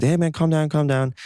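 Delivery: high-pitched retold plea; imitated urgent pleading. Young man to a yelling stranger, imitated high plea. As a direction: pitch it up to settle someone yelling.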